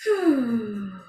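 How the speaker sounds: long, falling "hoo" exhale; audible out-breath sigh. Shown as a demonstration. Showing the group how to breathe out, long sighed exhale. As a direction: breathe out slowly and audibly through the mouth. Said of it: "breathe out through your mouth".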